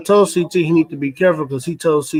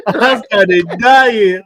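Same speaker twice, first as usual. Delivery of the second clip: laughing; 4 semitones higher, 5 dB louder, much slower than usual. Real, not acted. Laughing at retold joke.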